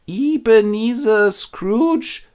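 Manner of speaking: high, drawn-out disbelieving reading; slow deciphering. Acted. Scrooge deciphers his gravestone, slow and high. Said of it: "where Scrooge deciphers".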